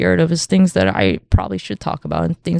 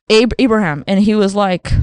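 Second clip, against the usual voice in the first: ordinary talk, nothing marked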